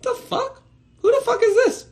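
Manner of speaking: high-pitched baffled disbelief. Pretend. Finding a stranger with ten million followers, high baffled shock. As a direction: squeal it in confused shock. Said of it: "And you're like".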